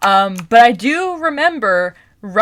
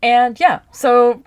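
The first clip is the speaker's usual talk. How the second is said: normal talking voice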